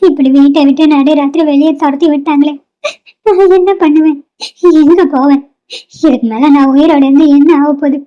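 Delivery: acted crying with sobs between phrases; tearful lament. Daughter thrown out at midnight by father, weeping. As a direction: weep to yourself, words broken by sniffles. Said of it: "நின்னு அழுதுட்டு இருக்கீங்க".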